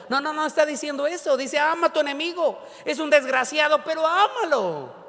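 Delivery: high-pitched, insistent preaching; impassioned exclaimed correction; emphatic correction. Acted. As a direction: hammer home the command, drawing out the last word. Emphatic correction, God's command against imagined version.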